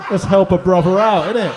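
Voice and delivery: booming laugh at himself; loud laughing. Real, not acted. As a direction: laugh it out to the crowd. Laughing self-justification.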